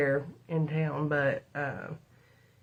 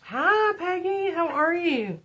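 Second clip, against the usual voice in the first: high, bright hello to a viewer; cheery greeting; 12 semitones higher than usual. Real, not acted. Vlogger greeting viewer Peggy in chat, high and cheery. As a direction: sing out a welcome.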